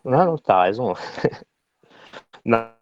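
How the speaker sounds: usual speaking voice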